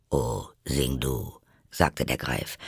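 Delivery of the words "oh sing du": low, soft creature voice; Griffin voice. Acted. Griffin remarking on the choking turtle, low character voice. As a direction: drop well down for the Griffin. Griffin hands over the singing.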